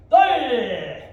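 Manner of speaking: explosive high kiai; sharp, loud combat yell; martial arts shout. Real, not acted. Instructor demonstrating a kick to the class, shouting.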